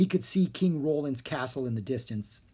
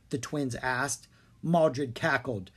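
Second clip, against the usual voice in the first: ordinary delivery, nothing marked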